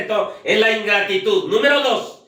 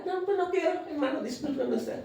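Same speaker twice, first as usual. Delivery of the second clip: 높고 가볍게 게으른 신자를 흉내 내며; 핑계 대는 게으른 신자를 살짝 과장해 흉내; mock lazy excuse; 10 semitones higher, 11 dB quieter than usual. Lazy believer excusing himself, mocked.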